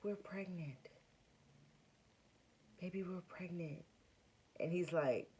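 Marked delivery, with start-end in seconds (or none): 0.0-3.8 very soft, breathless reveal; hushed announcement. Retold or imitated. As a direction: tell him almost under your breath, overwhelmed. Tearful whispered announcement to husband.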